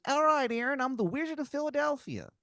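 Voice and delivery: a cartoon Philly guy bragging about his snacks; awful Philadelphia accent. Wizard driver offering hoagies in a terrible Philadelphia accent. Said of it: "the worst Philadelphia accent".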